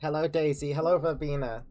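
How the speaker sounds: ordinary delivery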